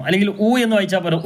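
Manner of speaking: ordinary talk, nothing marked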